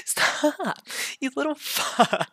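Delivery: high, drawn-out laughing protest; tickled squeal. Acted. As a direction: squeal curses while being tickled. Being tickled.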